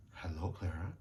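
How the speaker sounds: low, very soft greeting; hushed, mysterious hello; whispered. A narrator's reading. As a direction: murmur gently from just behind. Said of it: "whispered a voice behind her".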